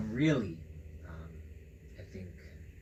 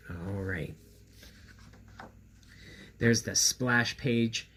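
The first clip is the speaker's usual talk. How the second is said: plain reading voice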